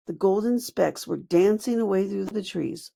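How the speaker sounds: usual speaking voice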